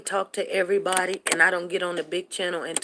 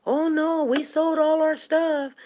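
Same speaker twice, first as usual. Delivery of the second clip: higher, drawn-out mock innocence; lightly acted innocent protest; 7 semitones higher, slower than usual. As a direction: play the liar, slow and high. Acting Ananias lying to church.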